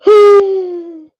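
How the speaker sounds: loud, high exaggerated sigh; big sigh. Real, not acted. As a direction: heave a theatrical sigh. Reacting to viewer chat.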